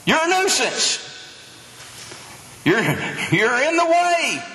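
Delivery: high-pitched mock scolding; acted crowd rebuke. People respond to the shouting blind man, high-pitched mock rebuke. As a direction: scold him in short, high bursts. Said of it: "look at the response of the people".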